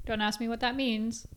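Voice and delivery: ordinary talk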